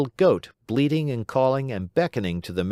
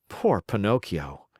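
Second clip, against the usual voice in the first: plain reading voice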